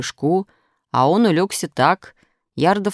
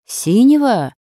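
higher, louder questioning repeat; puzzled echo; 8 semitones higher, 6 dB louder, slower than usual. A narrator's reading. Krasilnikov repeats the blanket's colour, puzzled and struck. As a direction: echo it back in wonder. Classmate echoes the answer in puzzlement.

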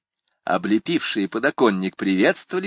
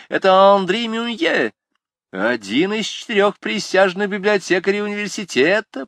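jeering student; 11 semitones higher, 4 dB louder than usual. A narrator's reading. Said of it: "подхватил другой".

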